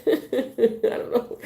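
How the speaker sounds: slow, high laughing mumble. Real, not acted. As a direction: laugh the words out. Laughing aside.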